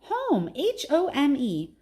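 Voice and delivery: high, excited and triumphant; excited cry. Acted. Julia shouting to her dad she was right, high and excited. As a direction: crow it out, spelling the word high. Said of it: "she cries".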